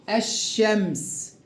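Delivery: الشمس slowly with a long double sh; lam merged into sh. Shown as a demonstration. Teacher shows the merging, slow doubled sh. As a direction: hold the ش in الشمس, lam silent. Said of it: "يلا نقرأ التدريبات تاني".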